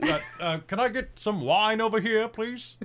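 high, loud call for wine. Acted. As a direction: call across the hall. Calling out for wine.

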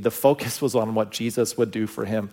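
ordinary delivery

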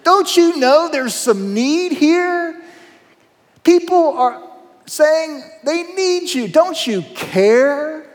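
high, loud, exasperated Peter; frantic Peter. Acted. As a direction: nag him like a worried friend who lost him. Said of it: "Peter sees him and said".

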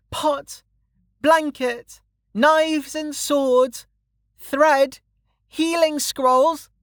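high-pitched, sing-out list; child hawker. Acted. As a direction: call each item out separately as a little girl at a stall. Child calls out list of wares.

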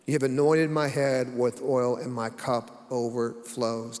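steady, declaiming psalm read; scripture reading. Acted. Reads Psalm 23 aloud.

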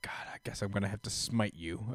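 whispered weary threat; whispered mock Thor. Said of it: "Thor's like".

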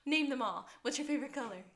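lightly mocked chirpy chatterbox; perky date. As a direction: gush questions like an overeager date. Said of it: "And she's like".

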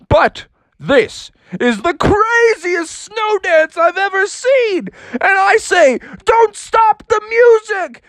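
a prankster cloud delighted by the dance; big laughing sky voice; booming cloud voice. Acted. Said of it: "the clouds started to speak".

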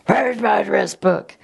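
lightly voiced dad searching for his book; dad asking. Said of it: "And so he did the".

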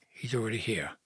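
soft, halting confession; hushed admission. A narrator's reading. Mary confessing to Joseph, hushed and reluctant. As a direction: let it out softly, almost under the breath. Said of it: "she whispered".

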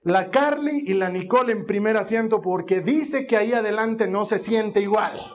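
ordinary talk

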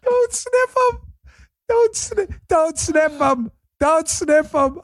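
high-pitched squeal of laughter through the words; helpless laughing imitation. Host repeating the guy in the background, helpless laughing. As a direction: mimic the heckler, cracking up, drawn out. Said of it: "Stop laughing".

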